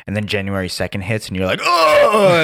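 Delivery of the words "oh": long, high, loud mock scream; hammed-up scream. Reacting as January second hits, hammed-up scream. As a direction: scream as if the workload just hit.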